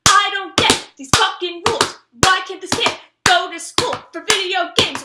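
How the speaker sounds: loud, high indignant yelling; public outburst. Retold or imitated. Fan making a scene at New York Comic Con, outraged yell. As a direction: shout the complaint so the whole hall hears. Outburst at convention, mother mortified.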